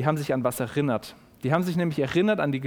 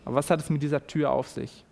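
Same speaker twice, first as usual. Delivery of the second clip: ordinary talk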